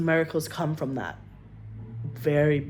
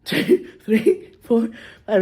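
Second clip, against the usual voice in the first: laughing; 6 semitones higher, 7 dB louder, slower than usual. Retold or imitated. Babcia counting eggs, speaker laughing.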